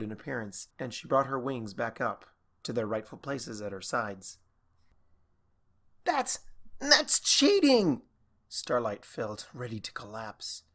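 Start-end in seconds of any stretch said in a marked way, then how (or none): 6.0-8.0 high, slow, stammering outrage; outraged protest. Acted. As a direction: cry foul in disbelief. Exhausted mare protests unfair play.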